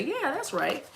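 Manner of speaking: high, soft voice from the audience; small high-pitched amen; agreeing witness. Acted. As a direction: chime in as an approving witness, high and light. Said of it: "witnesses in the comment section to say".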